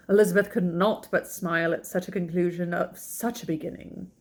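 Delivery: plain reading voice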